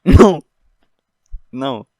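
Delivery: loud, high burst of "no" through a laugh; laughing protest. Real, not acted. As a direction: crack up and cry out no. Laughing burst at own blasphemous line.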